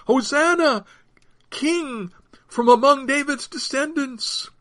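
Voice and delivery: high-pitched acclamation; crowd shout. A narrator's reading. Crowd hailing their king on the road, high shout. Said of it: "they shouted".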